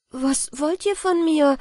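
frightened cry. A narrator's reading. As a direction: cry out in alarm, voice jumping up. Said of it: "rief ich".

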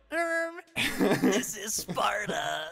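high, loud and slow, laughing; Sparta shout. Retold or imitated. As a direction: bellow it out, half laughing. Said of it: "you're like".

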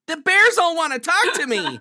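loud, high mock tourist cry; hammed-up clueless tourist, squealed at full volume; gushing tourist. Tourist thrilled by Yellowstone bears, loud hammed-up gushing.

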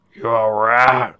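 loud, drawn-out question in the father's voice; concerned father. Acted. Father checking on his frail wife, concerned. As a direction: ask it loud and slowly as the old stallion.

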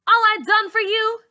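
very high, loud indignant cry; outraged. Acted. Bully feeling betrayed by her friend, outraged high shout. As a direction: throw her loyalty in her face. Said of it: "Char says".

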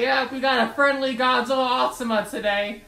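usual speaking voice

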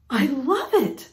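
lightly acted cry of delight; mild storybook shout. A narrator's reading. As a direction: shout it lightly in a put-on character voice. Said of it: "shouted the woman".

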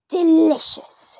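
slow relishing delicious; drawn-out smack of pleasure; savoring. Acted. Grandma relishes slugs.

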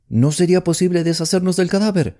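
sudden high-pitched urgent question; impulsive question. A narrator's reading. Young man to the woman about the body, impulsive. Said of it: "preguntó impulsivamente".

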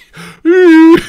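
high, loud explosive laugh; loud burst of laughter. Real, not acted. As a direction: crack up at the glitch. Laughs at grenade glitch.

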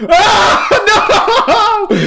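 high, loud cry of horror; horrified scream. Real, not acted. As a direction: shriek and wail in fright. Monster appears again.

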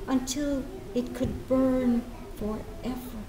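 soft, slow, tender recital; mother speaking softly to her son; tender mother's voice. Acted. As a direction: speak to your child gently.

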